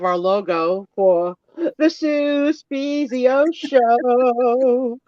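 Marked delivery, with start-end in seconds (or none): 1.8-5.0 drawn-out, high sing-song show announcement; show jingle announcer. Acted. Host unveils the show's new logo, grand drawn-out announcer. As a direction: stretch it out big like a theme jingle.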